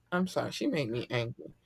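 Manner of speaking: ordinary delivery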